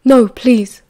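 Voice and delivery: loud, insistent plea. A narrator's reading. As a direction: plead with the volume up. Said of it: "Remus raised his voice".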